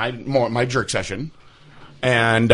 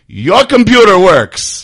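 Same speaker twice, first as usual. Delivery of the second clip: knowing mock announcement; 8 semitones higher, 10 dB louder, much slower than usual. Lady reveals the porn left on.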